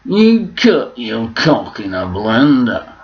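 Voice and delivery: presenter hanging on words, high and loud then dropping; slow, stretched-out TV host delivery; Jeremy Clarkson. Shown as a demonstration. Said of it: "supposed to be Jeremy Clarkson".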